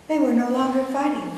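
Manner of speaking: plain reading voice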